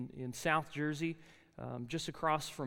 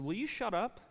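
retold curt put-down, pitched up; rude snap; 6 semitones higher than usual. New student cutting off his talkative roommate, mock irritated. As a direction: cut the roommate off, mock-annoyed.

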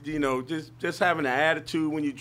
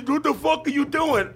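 angry yell; 9 semitones higher than usual. Retold or imitated. Mock road rage at driver.

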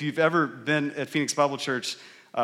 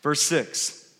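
usual speaking voice

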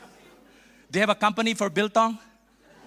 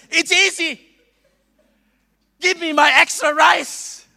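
high, loud mock boast; confident shout; 8 semitones higher, 8 dB louder than usual. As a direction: call out boldly like ordering food. Acted confident boast to the giants.

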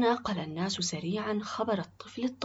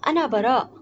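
loud, raised, self-assured announcement; confident; 5 semitones higher, 10 dB louder than usual. A narrator's reading. Boy steps forward before the men, confident. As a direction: declare yourself boldly. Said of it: "تقدم صاحب الصوت بثقة وقال".

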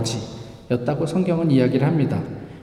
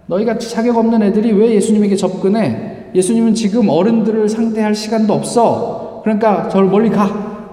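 higher, louder dismissive scolding; disciples scolding; 6 semitones higher, 5 dB louder than usual. Retold or imitated. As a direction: shoo the children away with contempt. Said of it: "제자들이 그들을 꾸짖었다".

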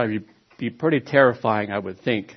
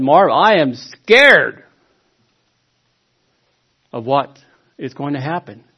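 scared quote; 4 semitones higher, 10 dB louder than usual. Retold or imitated. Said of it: "he said".

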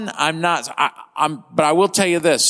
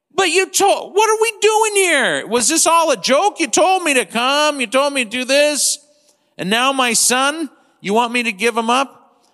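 much higher, pleading, hurt father; imagined Abraham protesting; 8 semitones higher than usual. Retold or imitated. Preacher voicing Abraham's protest to God, high and pleading. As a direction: cry out to God as a betrayed father. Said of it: "This is probably what I would say".

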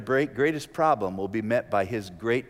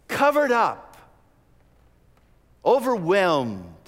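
loud, high preaching peak; 7 semitones higher, 6 dB louder, slower than usual. Acted. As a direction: cry out covered up, then drawn out overwhelmed. Sermon climax on guilt.

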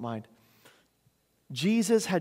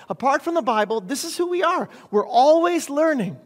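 loud, high preacher's declaration; impassioned preaching; 7 semitones higher, 9 dB louder than usual. Real, not acted. Preacher telling society who we are, impassioned. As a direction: drive it home from the pulpit.

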